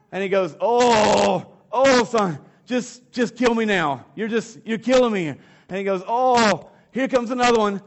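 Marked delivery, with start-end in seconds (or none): 0.6-5.3 put-on higher and louder, a dying old father milking it; hammed-up fake heart attack. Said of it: "His dad fakes a heart attack". 6.1-7.8 put-on higher and louder, a dying old father milking it; hammed-up fake heart attack. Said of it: "His dad fakes a heart attack".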